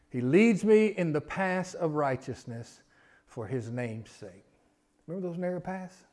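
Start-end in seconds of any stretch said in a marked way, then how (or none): 0.1-4.4 very slow, pausing scripture reading. Shown as a demonstration. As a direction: read each phrase slowly with pauses. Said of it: "I wanna read 'em kinda slow".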